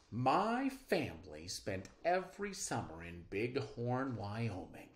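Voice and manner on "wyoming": ordinary talk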